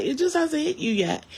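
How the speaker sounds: high, mocking sing-song; a knowing mock from people telling her grief will come later; mocking know-it-all. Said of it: "some people are just like".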